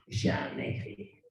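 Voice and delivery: breathy, slow whisper; whispered vow. Real, not acted. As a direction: let it out softly as a promise. (chuchoté lentement, comme une promesse)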